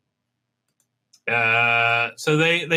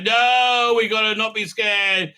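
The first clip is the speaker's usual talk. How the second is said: mock brave leader; 6 semitones higher, slower than usual. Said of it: "she's like".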